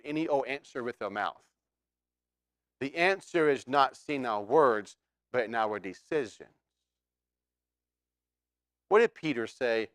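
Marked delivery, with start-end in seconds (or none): none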